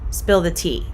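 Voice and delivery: ordinary delivery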